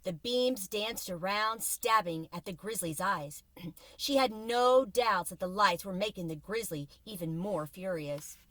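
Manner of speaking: usual speaking voice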